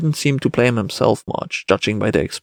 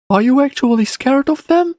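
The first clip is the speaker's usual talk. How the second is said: put-on high-pitched child's question; boy asking; 3 dB louder than usual. A narrator's reading. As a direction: ask it in a little boy's high voice. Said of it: "whereupon the boy asked".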